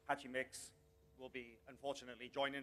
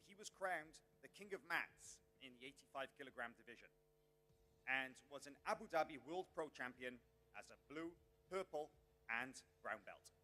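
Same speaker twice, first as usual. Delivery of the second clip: normal talking voice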